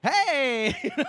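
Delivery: high, loud fake-bright greeting; forced cheerful hey. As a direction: snap into fake cheer for the fan. Forced cheerful reply acted out.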